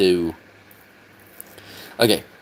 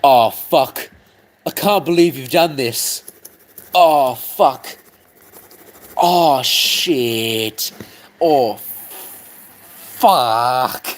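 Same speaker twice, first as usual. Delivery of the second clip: drawn-out cursing in a put-on voice; Ryan; 3 dB louder, much slower than usual. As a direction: groan out each curse in dismay. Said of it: "what would Ryan do in this situation?".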